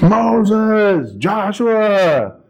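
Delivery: high, drawn-out crowd call; mock yell. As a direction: hail a leader across a field. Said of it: "They were yelling at Joshua".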